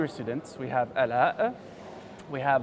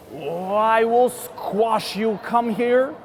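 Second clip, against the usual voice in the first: slow, loud, higher furious bear; angry threat; 4 semitones higher, 4 dB louder, slower than usual. Acted. Teacher voicing the bear chasing the bee, furious. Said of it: "gets angrier and angrier".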